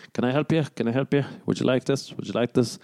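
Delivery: lightly voiced shop assistant, each offer repeated; pestering shop assistant. Shop assistant plaguing a browsing customer, lightly pestering. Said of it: "clothes shop assistant".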